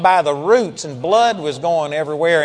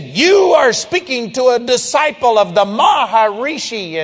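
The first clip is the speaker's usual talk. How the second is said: loud, raised-pitch proclamation; retold angry yell, higher and louder; imitated yell; 5 semitones higher, 5 dB louder than usual. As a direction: thunder it in someone's face, mimicking him. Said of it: "he yelled at me out, out loud".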